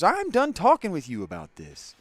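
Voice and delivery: high, loud, blunt dismissal in a put-on voice; curt shutdown. Streamer imitating Trevor ending the talk, loud high dismissal. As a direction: slam the conversation shut. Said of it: "He just goes".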